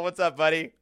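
normal talking voice

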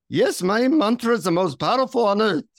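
lilting high mock-Indian accent. Guru greeting a visitor who travelled far, Indian accent. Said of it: "he says in his deep Indian accent".

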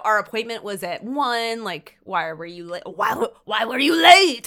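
a hint of a French accent, rising to loud; mock rude French boss. Host imitating the rude French boss Emile, mock French accent. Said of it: "very stereotypical French".

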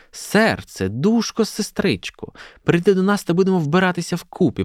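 high-pitched coaxing; pleading. A narrator's reading. Olha begs her friend to dress up together, coaxing. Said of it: "просила Ольга".

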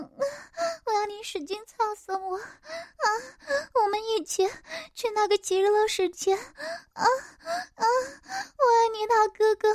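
high, breathless cries of pleasure; moaning. Acted. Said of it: "小燕浪叫着".

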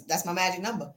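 usual speaking voice

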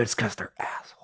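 whispered aside. Real, not acted. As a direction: drop to a whisper. Conspiratorial aside.

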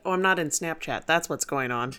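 ordinary talk, nothing marked

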